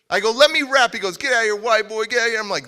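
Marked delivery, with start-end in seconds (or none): 0.3-0.9 raised, louder reenacted demand; insistent demand. Retells demanding to join the battle. 1.2-2.4 raised, louder mock brush-off; dismissive rebuff. Retells being brushed off by rapper.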